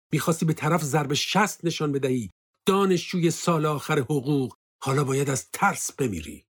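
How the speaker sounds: heated, accusing outburst; furious accusation. A narrator's reading. Furious accusations while shaking the lieutenant.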